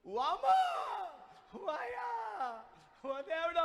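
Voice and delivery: high, loud mock wail, one word drawn out very slowly. Caught thief rolling on the floor, a high, loud mock wail. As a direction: howl like a caught thief faking pain. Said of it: "దొర్లాడు ఏడుస్తున్నాడంట".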